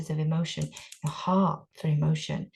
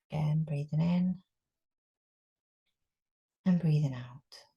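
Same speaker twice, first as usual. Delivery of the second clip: guided meditation. Acted. Teacher guiding a group through breathing, calm meditation voice. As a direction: ease them into stillness.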